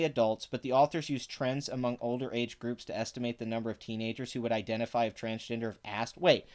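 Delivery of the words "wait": ordinary talk, nothing marked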